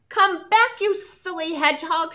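loud high call after him. Acted. Lisa calls after the runaway hedgehog, loud. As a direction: call him back across the yard. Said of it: "she called".

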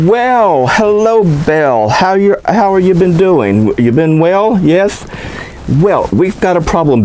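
greeting. Acted. Greeting the summoned demon.

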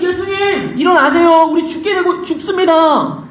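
high-pitched mock pleading; acted urgent plea. Disciples waking Jesus in the storm, acted urgent plea. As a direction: cry for help as a frightened disciple.

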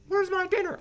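high, loud shrieking demand for dinner; hammed-up bossy wife yelling; mock demanding wife. Acted husband-wife dinner quarrel.

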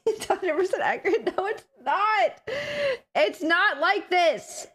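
high, slow put-on voice of a cutesy meme self; mock squeaky version of herself. Streamer parodies how chat's meme portrays her, in a high cutesy voice. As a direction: play the silly version chat imagines of you. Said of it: "I don't sound like this".

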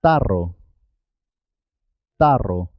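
trilled rr in "Tarro"; rolled double R. Shown as a demonstration. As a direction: model "Tarro" with a vibrating RR. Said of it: "¿Puedes pronunciar tú?".

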